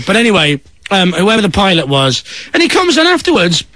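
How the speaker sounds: ordinary talk, nothing marked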